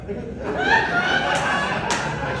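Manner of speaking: a shrill burst of laughter high above the voice; high cackling laugh. Real, not acted. Comic laughing at his own joke, high cackle.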